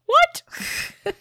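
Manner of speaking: high, startled what; squeaked high in confusion; baffled what. Retold or imitated. Imitating Jyn's look.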